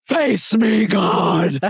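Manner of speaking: shouted challenge, pitch up and loud; defiant shout. Retold or imitated. Delirious patient shouting a challenge at God, loud defiant call. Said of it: "he says".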